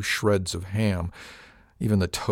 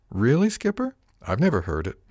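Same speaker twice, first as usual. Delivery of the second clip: put-on much higher, surprised young woman; Miss Arione voiced; 6 semitones higher than usual. Crew mate surprised by captain's old phrase, higher voice. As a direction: pitch up in surprise.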